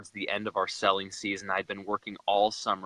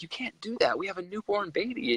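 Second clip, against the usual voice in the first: mildly mad wife; 8 semitones higher than usual. Retold or imitated. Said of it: "she was mad at me".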